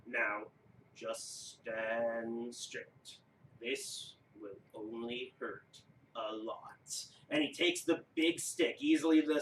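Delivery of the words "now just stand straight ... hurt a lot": low, quiet, very slow giant threat; deep slow giant. Acted. Giant warns player before carving, slow menace. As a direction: menace him gently, dragging it out. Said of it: "wrong voice, that's two words".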